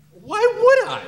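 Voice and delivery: very high, loud comic retort; hammed-up incredulous yell; indignant shout. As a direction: squawk it back in mock outrage. Acted retort to store clerk.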